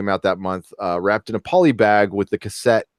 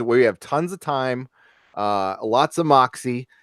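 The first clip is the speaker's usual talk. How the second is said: usual speaking voice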